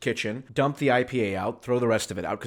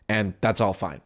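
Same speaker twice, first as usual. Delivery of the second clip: ordinary delivery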